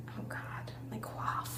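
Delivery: mortified whisper. Real, not acted. Laughing at her own old letter, whispered. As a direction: whisper it to yourself in embarrassment. Embarrassed at word choice.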